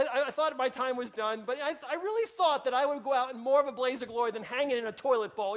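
usual speaking voice

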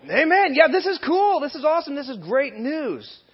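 very high, hammed-up cheering; loud squeal easing off into stretched-out delight; mock excitement. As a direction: overdo the enthusiasm like a thrilled crowd. Acts out congregation's excited response.

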